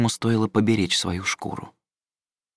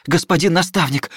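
high, loud urgent call; blurted call; 8 semitones higher, 4 dB louder than usual. Acted. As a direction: burst out calling the mentor. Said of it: "выпалил он".

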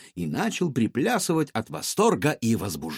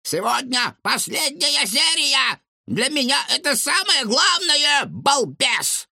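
high, loud scolding granny; angry old woman; 4 dB louder than usual. A narrator's reading. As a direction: yell at the fool next door. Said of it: "соседка".

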